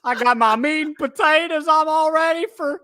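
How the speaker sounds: hammed-up Southern twang, high and loud; Southern accent. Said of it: "like, a Southern accent".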